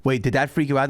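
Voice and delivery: ordinary talk, nothing marked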